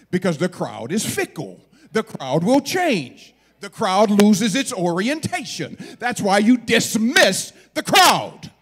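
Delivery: loud, building declaration with heavy stresses; full-force preaching. Real, not acted. Preacher warning young people against following the crowd, full force. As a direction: hammer each point home to the congregation.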